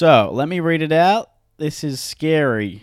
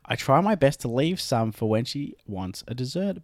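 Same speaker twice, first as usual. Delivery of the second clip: ordinary talk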